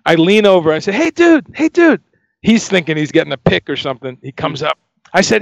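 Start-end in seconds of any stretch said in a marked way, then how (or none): 0.9-2.0 high-pitched, quick call for attention. Retold or imitated. Guitarist leans over to a fan, calling out high.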